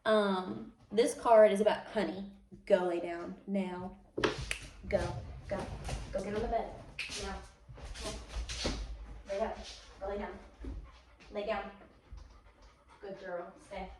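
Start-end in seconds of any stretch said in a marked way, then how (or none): 1.9-13.9 firm dog command. Real, not acted.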